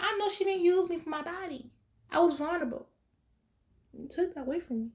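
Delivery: hammed-up vulnerability; mock wounded. Mock wounded speech, then "I'm playing.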